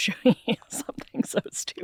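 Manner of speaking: hushed conspiratorial whisper. Real, not acted. Friend whispering a secret to her podcast cohost. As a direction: lean in and whisper it. Whispered tease before showing photo.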